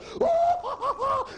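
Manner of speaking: squealing high laugh; high burst of laughter. Real, not acted. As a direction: laugh out loud at the joke. Narrator laughing hard.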